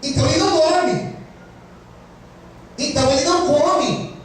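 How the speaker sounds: preaching. Acted. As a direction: preach it out to the church. Preaching about Nehemiah. (pregação fervorosa)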